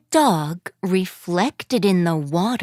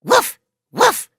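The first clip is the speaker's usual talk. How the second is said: dog bark; 12 semitones higher, 4 dB louder than usual. Acted. Dog barks in story.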